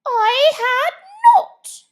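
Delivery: high, loud angry retort; sharp angry cry. Acted. Mouse angrily denying Alice, loud and sharp. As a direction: bite the words off sharply. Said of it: "cried the mouse sharply and very angrily".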